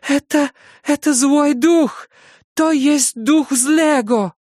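slow, high hysterical shriek; seething shrieking spirit. A narrator's reading. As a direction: wail it out in terror. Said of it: "вопит мать, заливаясь слезами".

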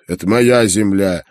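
loud, high-pitched angry rant; irate old merchant defending his land; grumbling defiance. A narrator's reading. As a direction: rail at the intruder, loud and defiant. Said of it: "Ворча, он всё-таки оставил".

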